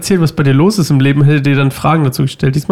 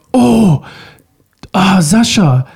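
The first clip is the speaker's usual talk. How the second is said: higher-pitched "oh" of sudden recognition; mock surprised double take; retold surprise; 4 semitones higher, much slower than usual. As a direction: imitate someone startled to recognize a friend. Retold neighbour recognizing him at last.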